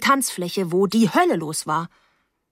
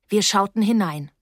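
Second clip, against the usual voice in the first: ordinary delivery, nothing marked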